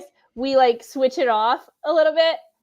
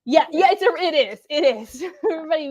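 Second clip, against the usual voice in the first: ordinary talk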